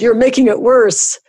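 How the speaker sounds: high, loud pained complaint; howling. Acted. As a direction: howl it out, desperate. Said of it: "he howled".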